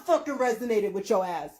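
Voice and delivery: muttered aside; low and fast. Real, not acted. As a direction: drop it low and rush. Ending rant dropping voice.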